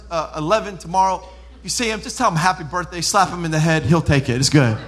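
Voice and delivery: ordinary talk, nothing marked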